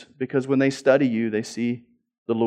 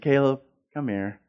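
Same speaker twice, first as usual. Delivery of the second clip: usual speaking voice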